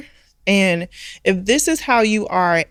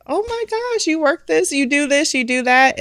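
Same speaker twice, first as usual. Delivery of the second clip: starts much higher, then settles while growing louder; mock gushing customer; 9 semitones higher, 3 dB louder than usual. Customers discovering she owns the restaurant, imitated excited gush. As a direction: gush with amazement, then run through the list.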